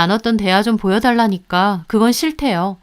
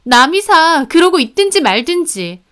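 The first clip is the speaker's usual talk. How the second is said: high, loud defiant retort; bratty, defensive comeback; stubborn insisting; 7 semitones higher, 5 dB louder than usual. A narrator's reading. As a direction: snap that it's none of their business. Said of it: "신우가 박박 우겨대는".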